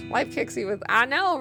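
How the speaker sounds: ordinary delivery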